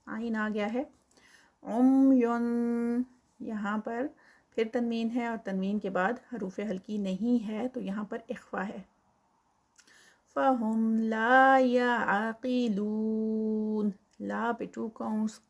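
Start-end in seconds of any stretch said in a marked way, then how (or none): 1.6-3.0 slowly chanted and a little louder; recited word. Shown as a demonstration. Teacher chanting the word for students, a touch louder. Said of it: "recites the word with tanween". 10.3-13.9 slow, chanted recitation; Quran recitation. Shown as a demonstration. Teacher repeating the phrase for students, slow and chanted. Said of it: "repeats the phrase as a recitation".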